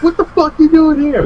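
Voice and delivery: very high-pitched mock astonishment; imitated amazed fan. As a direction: squeal it in put-on disbelief.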